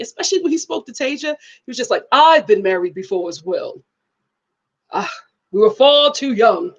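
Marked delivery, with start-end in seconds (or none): 2.1-6.7 lightly imitated grave older man; a hint of a solemn, lower dad; solemn deep-voiced father. Dad confiding to Tasia, lightly voiced solemn father. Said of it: "the way he was speaking".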